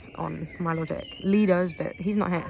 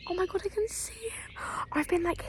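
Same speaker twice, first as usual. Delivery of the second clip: thrilled hushed whisper pushed hard on best moment; excited whisper; 12 semitones higher, 6 dB quieter than usual. Real, not acted. Vlogger thrilled after seeing a badger, excited whisper. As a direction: whisper your amazement without a sound.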